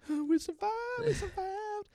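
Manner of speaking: high-pitched squeaky joy; excited cheer. Retold or imitated. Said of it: "Davian and Wer are like".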